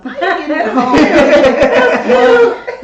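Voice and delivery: high, loud laughing question; laughing disbelief. Retold or imitated. Mother laughing at her son's paycheck plan, incredulous. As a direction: laugh through the retort at him.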